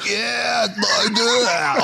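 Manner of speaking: high, imitated rowdy fan yell; mock drunken cheering. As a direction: holler it like a drunk fan, mimicking him. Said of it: "Kevin's up and he's F-bombing".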